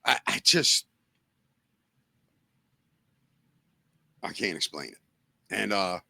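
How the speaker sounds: real emotion, near whisper; choked up. Real, not acted. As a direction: voice catching, words barely coming out. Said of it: "I'm emotional because we tried so hard".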